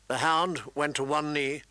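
ordinary delivery, nothing marked

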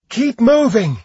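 urgent high shout; yelling. Acted. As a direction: shout it over the battle. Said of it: "Dia yelled".

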